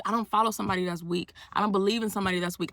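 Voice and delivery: ordinary delivery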